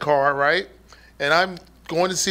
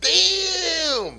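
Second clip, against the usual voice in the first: mock exclamation; 10 semitones higher than usual. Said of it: "Poop was just doing bit right there".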